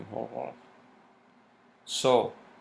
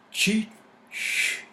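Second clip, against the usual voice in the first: high, airy whisper of "Cheat sheet"; a playful whispered aside; whispered echo; 12 semitones higher, 3 dB louder than usual. Real, not acted. Playful echo of own words.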